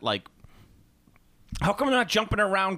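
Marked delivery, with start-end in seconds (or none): 1.6-2.8 high-pitched mock complaint; upset prequel fan. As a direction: play a put-out fan, pitched up. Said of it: "might be a little more upset".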